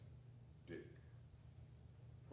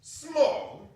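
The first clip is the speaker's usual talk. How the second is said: indignant echo; 10 semitones higher, 4 dB louder than usual. Acted. Scrooge retorts indignantly.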